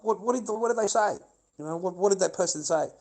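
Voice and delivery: high, repeated, baffled questioning; acted insistent questioning. Acts out his own unanswered questions.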